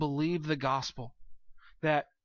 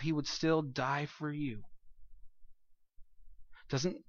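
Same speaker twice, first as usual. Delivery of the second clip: ordinary talk, nothing marked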